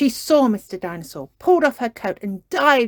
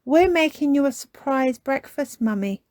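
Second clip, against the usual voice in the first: explaining; 7 semitones higher, 4 dB louder than usual. A narrator's reading. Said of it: "explained Peppa".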